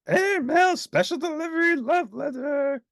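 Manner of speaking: high, loud cheerful yell while flying. A narrator's reading. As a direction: call it out mid-flight. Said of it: "yelled Grover".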